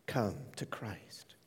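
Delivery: slow, soft whisper. Real, not acted. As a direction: plead gently in a whisper. Pleading close of appeal.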